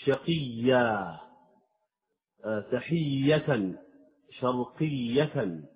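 شقيا تحية شرقية بتشديد واضح وبطء شديد; أبرز شدة الياء في كل كلمة; doubled ya. Shown as a demonstration. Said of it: "هكذا". (تشديد الياء ببطء)

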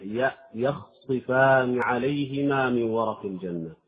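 يخصفان بفتح الياء بوضوح; افتح ياء يخصفان ولا تكسرها; open ya. Shown as a demonstration. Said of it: "لا بد من الفتح". (فتح الياء في يخصفان)

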